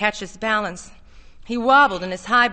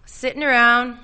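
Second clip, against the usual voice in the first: sitting around said higher and louder; emphatic, raised delivery; energetic; 3 semitones higher, 8 dB louder than usual. A narrator's reading. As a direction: confirm it with new energy, the picture now clear. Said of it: "he nodded energetically".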